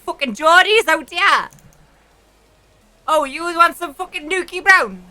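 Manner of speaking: loud, high-pitched Geordie shout; Geordie accent. Shown as a demonstration. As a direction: holler like a hammed-up Geordie. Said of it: "A fucking Geordie".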